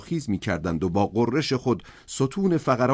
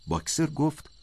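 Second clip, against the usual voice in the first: usual speaking voice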